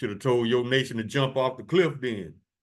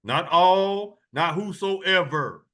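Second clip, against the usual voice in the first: higher and louder, slow on whosoever; emphatic preaching; 7 semitones higher, 4 dB louder, much slower than usual. Real, not acted. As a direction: hammer home the exclusion. Preacher stresses exclusivity.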